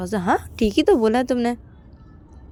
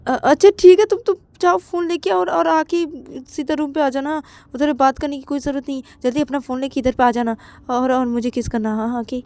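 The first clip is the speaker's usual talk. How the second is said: eager lover; 6 semitones higher than usual. Acted. Ananya telling Payal to come straight back. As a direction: hurry her back eagerly.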